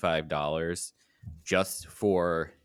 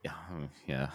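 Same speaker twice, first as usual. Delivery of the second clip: whispered, much softer; whispering; 3 semitones lower, 11 dB quieter than usual. Real, not acted. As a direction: drop to a breathy whisper. Hushed aside after ticket gripe.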